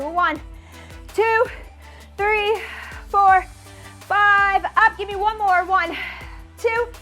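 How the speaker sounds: ordinary delivery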